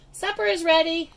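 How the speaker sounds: high loud call up the stairs. A narrator's reading. Mom calls daughter to supper, high loud call. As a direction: sing it out to the house. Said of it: "yelled Mom".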